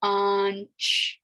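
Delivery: "bunch" with a barely heard n; "bunch", its n almost hidden; subtle nasal n. Shown as a demonstration. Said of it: "the N is kind of subtle".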